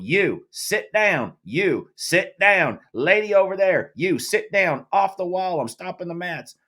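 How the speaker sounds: high, slow, loud Southern commands one by one; pointing and ordering. Retold or imitated. Official pointing at people in the stands, high slow Southern orders. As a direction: jab each order at a spectator, country drawl. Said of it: "started pointing at people going".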